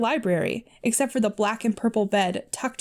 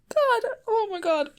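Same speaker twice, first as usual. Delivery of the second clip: real high, tearful wail of cringe; high voice, crying with embarrassed laughter; laughing to tears; 13 semitones higher than usual. Real, not acted. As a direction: squeak it out in pain from cringing. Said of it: "I'm crying because this is painful".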